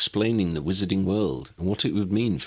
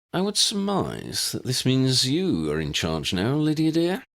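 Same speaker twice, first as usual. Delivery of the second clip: higher, dry and wryly amused; a gently ironic remark; wry smile; 8 semitones higher than usual. A narrator's reading. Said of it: "Ambrose noted with a wry smile".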